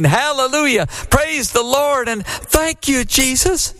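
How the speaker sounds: preacher crying out hallelujah to the ceiling; joyful shout. A narrator's reading. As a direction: shout praise up to the rafters. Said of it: "shouted upwards".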